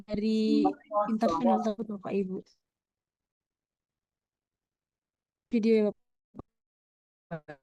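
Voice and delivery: choppy broken connection; words cutting in and out; glitching audio. Real, not acted. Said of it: "karena tadi putus-putus".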